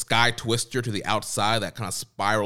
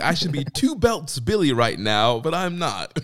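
mock bitter whine; 6 semitones higher, 5 dB louder than usual.

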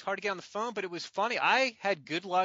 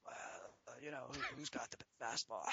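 very soft, low croak; worsening lost voice; 5 semitones lower, 16 dB quieter than usual. Retold or imitated. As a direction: rasp faintly as the voice gives out. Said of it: "my voice is getting worse".

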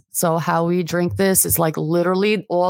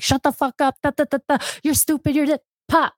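much higher, mocking caricature of a rude husband; harsh village man; 8 semitones higher, faster than usual. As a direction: spit it out like a boorish man shouting down his wife. Mocking hardcore village Albanian man.

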